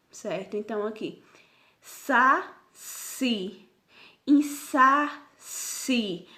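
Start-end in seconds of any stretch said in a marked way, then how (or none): 1.8-6.3 "sa-si" in slow, separate syllables, each held; syllable-by-syllable demo. Shown as a demonstration. Teacher showing an oxytone to pupils, "insa-si" broken into slow syllables. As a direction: split "insa-si" with a long gap before a firm final si. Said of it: "O si, ele é a sílaba tônica".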